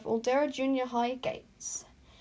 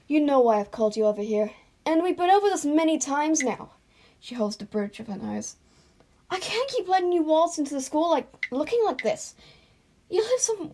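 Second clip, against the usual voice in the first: put-on louder, exasperated teacher scolding a kid; stern teacher; 4 semitones higher, 6 dB louder than usual. Counsellor scolding Izuku about his uniform, loud and exasperated. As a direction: lecture him like a tired counsellor.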